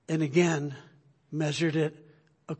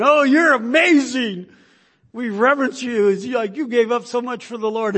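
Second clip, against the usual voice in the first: gushing admirers; 7 semitones higher, 9 dB louder than usual. Retold or imitated. Admirers gushing over Livingstone at the end of his life, high and loud. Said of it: "they were going".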